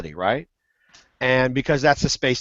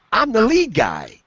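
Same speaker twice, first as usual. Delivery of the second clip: higher, slower, with a hint of a Spanish accent; amused Spanish accent; 7 semitones higher, slower than usual. [name] recalling the caller's punchline, amused mock Spanish accent. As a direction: savour the caller's phrase in a light mock accent. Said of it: "uh, a Spanish accent".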